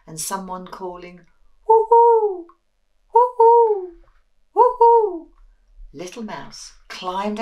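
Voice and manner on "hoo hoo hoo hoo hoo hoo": loud, high hooting owl; very high loud owl hoo. Acted. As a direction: call it out like an owl.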